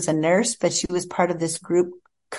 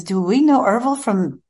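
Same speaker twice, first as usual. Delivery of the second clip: a hint of a puzzled husband, climbing higher and slowing; lightly voiced husband asking in earnest; husband asking his wife about her loss, slightly higher and slower; 6 semitones higher, 3 dB louder, slower than usual. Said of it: "He says".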